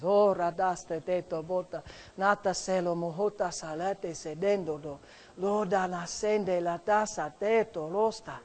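slow, soft flowing tongues; quiet glossolalia prayer; praying in tongues. Real, not acted. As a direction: murmur the syllables prayerfully. Pastor prays in tongues.

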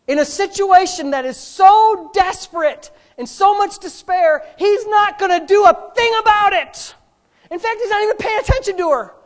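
high, loud preaching climax; loud preaching peak; preaching shout. Real, not acted. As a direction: raise it to a full-force preaching cry.

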